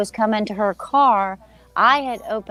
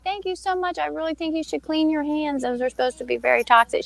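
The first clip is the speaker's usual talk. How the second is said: nervous politeness; 7 semitones higher than usual. Retold or imitated. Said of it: "I was like".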